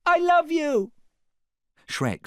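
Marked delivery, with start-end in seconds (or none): 0.1-0.9 high, loud call; crying out. A narrator's reading. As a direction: shout your love across the water. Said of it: "cried Fiona".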